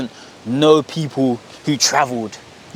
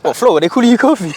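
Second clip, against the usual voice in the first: louder, higher incredulous questions; amused disbelief; 8 semitones higher, 5 dB louder than usual. Retold or imitated. Home friends learning his uni name, imitated amused disbelief. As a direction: imitate friends who can't believe it.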